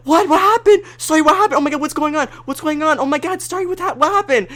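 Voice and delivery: very high, frantic imitation of the chat; imitating friends' alarmed replies in the chat; mock panic. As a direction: play the confused crowd in a squeaky flurry. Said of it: "And everyone's like".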